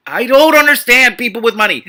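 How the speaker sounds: high, loud exasperated cry; exasperated outburst. Real, not acted. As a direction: throw up your hands in disbelief.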